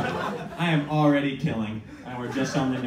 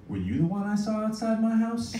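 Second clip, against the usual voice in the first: serious coworker; 8 semitones higher than usual. Retold or imitated. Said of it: "gets very, very serious".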